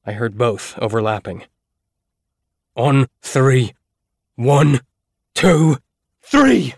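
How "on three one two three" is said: loud from the start, peaking high on "three"; building countdown. Acted. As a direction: count again, harder, to the shout. Counting down to ram door again.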